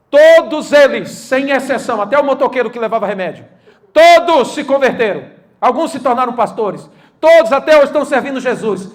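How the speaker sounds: loud, building sermon close; preaching at full force. Acted. Pastor proclaiming to the congregation, loud preaching. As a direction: preach it out to the church.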